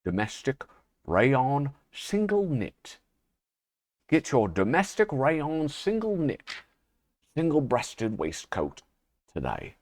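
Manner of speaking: slow, drawn-out mock British announcer; British accent. Host imitating a TV announcer selling clothing, slow British accent. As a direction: attempt a plummy British sales pitch, stretching the words. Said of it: "how do you even do a British accent".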